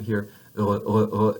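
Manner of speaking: throaty uvular "r" at the back; French "r" scraped at the back of the tongue. Shown as a demonstration. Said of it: "you can see also".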